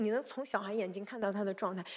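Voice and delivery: normal talking voice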